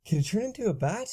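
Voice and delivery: fast, high, eager question; excited gasp. Acted. Narrator gasping at her vampire friend, excited and high. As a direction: blurt it out with wonder.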